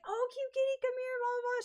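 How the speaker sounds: high excited calling fan. Retold or imitated. Fan calling her at night's end, high excited call. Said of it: "another one at the end of the night that was like".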